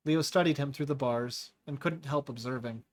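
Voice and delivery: ordinary delivery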